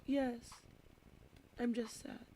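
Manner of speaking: put-on small, high, soft kid voice; sad little child. Sad kindergartner admitting it to her teacher, small and soft. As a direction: imitate a meek, sorrowful kindergartner.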